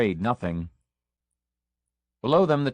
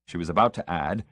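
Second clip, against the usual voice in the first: ordinary delivery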